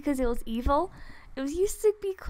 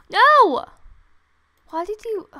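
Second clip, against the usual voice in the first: sudden loud, high cry of dismay; alarmed shout; 7 semitones higher, 13 dB louder than usual. Real, not acted. Gamer to viewers as the game goes wrong, dismayed shout. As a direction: yell it in shock, pitch leaping up.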